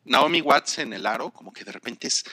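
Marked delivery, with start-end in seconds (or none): none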